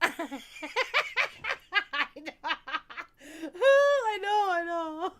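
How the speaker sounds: high, loud laughing through the words; can barely speak for laughing; real laughing; laughing hard. Real, not acted. Laughing fit.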